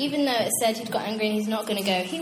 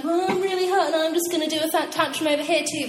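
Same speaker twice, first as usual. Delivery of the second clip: higher, mock hurt whine; sulking tantrum; 6 semitones higher, 4 dB louder than usual. God imagined throwing a tantrum, mock hurt whine. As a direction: play a huffy child. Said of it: "he's not like".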